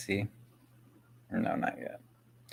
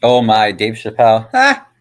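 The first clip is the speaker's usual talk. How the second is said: excited; 13 dB louder than usual. Real, not acted. Finds Dave Chappelle clip, thrilled.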